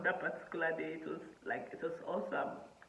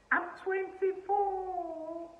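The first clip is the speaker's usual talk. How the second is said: "I'm twenty-four" stretched slow and high; stress on twenty-four; 13 semitones higher, 6 dB louder, much slower than usual. Real, not acted. Stressing her age to the viewers, slow and high.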